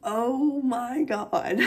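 drawn-out, very slow exclamation; overwhelmed amazement. Real, not acted. Vlogger seeing her gift, long overwhelmed gasp of words. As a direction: stretch every word in real awe.

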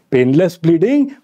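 normal talking voice

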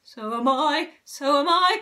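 loud, rising wail; alarmed character outcry; distressed cry. A narrator's reading. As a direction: cry it twice, climbing in pitch. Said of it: "cried Mister Twit".

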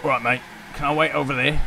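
put-on London geezer accent; casual cockney lad voice; British accent. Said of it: "this voice is killing me".

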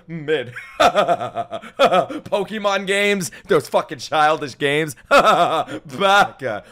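derisive laughs between words; mocking laughter. Streamer parodying hot takes, mock laughing. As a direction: scoff and laugh through the put-down.

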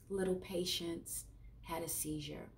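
usual speaking voice

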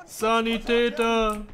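drawn-out call for a medic; shouting orders over battle. Real, not acted. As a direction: call the medic slowly and loudly. (langgezogener Ruf nach dem Sanitäter)

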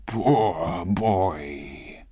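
long, drawn-out sorrowful sigh of words; pitying. A narrator's reading. Scrooge pities his former self, pitying. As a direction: linger on each word with tender pity. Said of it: "he said in pity for his former self".

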